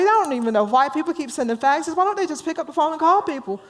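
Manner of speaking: high, flustered run-on chatter; hammed-up complaining, pitched high and rushing on; rambling romantic. Joe grumbles to Bob about the fax machine, flustered. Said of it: "says to Joe, the romantic".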